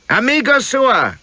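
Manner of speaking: high, drawn-out call to a boatman; cried out. A narrator's reading. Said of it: "cries he".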